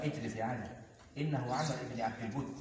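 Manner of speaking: soft, low, measured command; quiet restraint. Acted. The Prophet telling Ali to sit, soft and low. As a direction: hold him back gently, voice down.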